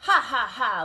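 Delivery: big hearty "ha, ha, ha" laugh, loud; loud mock laugh. First pig mocking his hardworking brother, loud laugh. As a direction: belt out a jeering laugh. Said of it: "laughed the first little pig".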